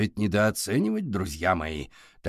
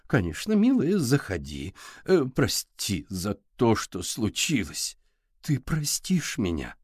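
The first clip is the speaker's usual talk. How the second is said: apologetic librarian. Acted. Librarian to the girl she attacked, hesitant apology. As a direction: ask forgiveness hesitantly, drawing the words out.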